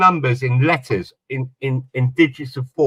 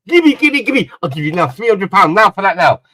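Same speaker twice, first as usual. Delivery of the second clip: much higher, louder grabby give me, give me; eager shopkeeper; 4 semitones higher, 5 dB louder than usual. Retold or imitated. Shopkeeper friend begging to buy the phone, excited and high. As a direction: beg for it like a greedy shopkeeper. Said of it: "He goes".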